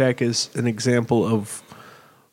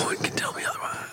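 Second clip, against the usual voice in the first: whispering; 11 dB quieter than usual. Real, not acted. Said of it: "Why are we whispering?".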